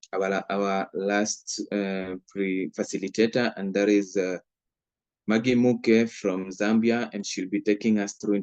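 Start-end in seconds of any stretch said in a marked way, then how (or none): none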